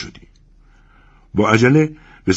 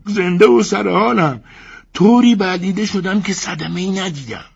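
Ivan's voice; 13 semitones higher, 5 dB louder than usual. Acted. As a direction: reassure her from the belly. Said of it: "ایوان موتویچ جواب داد".

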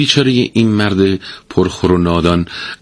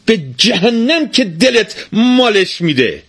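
much higher, slow, furious cry; a fed-up wife screaming at her husband; angry shout; slower than usual. A narrator's reading. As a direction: fling it at him in fury. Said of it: "با عصبانیت فریاد زد".

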